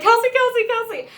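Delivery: high, loud repeated call of a name; retelling how she yelled to the singer. Retold or imitated. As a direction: shout the name across the barricade. Calling out to the singer.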